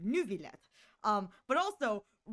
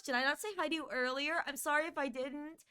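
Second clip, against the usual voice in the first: ordinary talk, nothing marked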